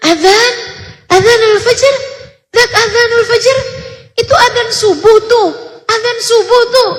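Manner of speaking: put-on high, shaky voice of a frail old lady woken by the dawn call; a trembling elder calling out about the azan, pitched well up; old woman trembling at the dawn azan. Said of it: "Lututnya gemeteran".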